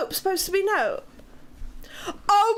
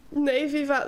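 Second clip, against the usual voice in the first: normal talking voice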